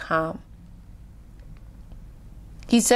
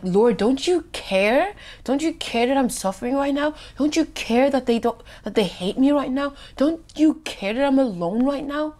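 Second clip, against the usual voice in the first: higher-pitched, acted plea to the Lord; pleading complaint; 5 semitones higher than usual. Believer asking Jesus why he doesn't care, pleading. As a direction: plead with each question, pitch raised.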